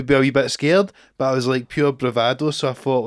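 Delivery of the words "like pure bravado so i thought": normal talking voice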